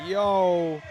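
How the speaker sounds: normal talking voice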